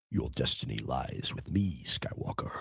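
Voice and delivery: very deep, soft, menacing villain voice; Darth Vader impression. Doing a Vader line for the stream, very deep and soft. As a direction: breathe the threat low and soft.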